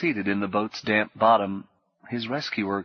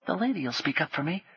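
put-on much higher boy's voice, hushed and quick; urgent potboy; 7 semitones higher, 4 dB quieter than usual. Potboy warning Paul of soldiers, high and hushed. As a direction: warn him like a frightened street boy.

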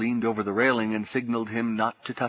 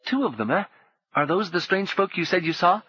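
put-on boy's voice; 8 semitones higher than usual. A narrator's reading. Gally questioning.

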